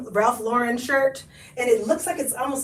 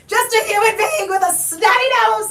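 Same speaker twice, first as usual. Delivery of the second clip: loud, higher, laughing through it; laughing shout; 9 semitones higher, 4 dB louder than usual. Real, not acted. Vlogger laughing at her own runny nose. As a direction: laugh it off loudly.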